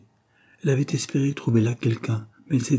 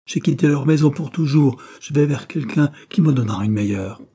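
louder, raised storytelling voice; heated outburst; 5 semitones higher, 6 dB louder than usual. A narrator's reading. Caroline leaving home for good, a heated outburst. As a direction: declare it forcefully, pitched up at the start. Runaway's outburst to her friend.

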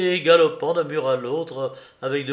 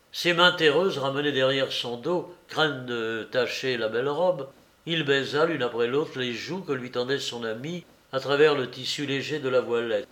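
ordinary delivery, nothing marked

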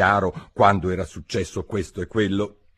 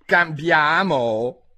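cried out; 8 semitones higher, 4 dB louder, much slower than usual. A narrator's reading. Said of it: "gridò il topo".

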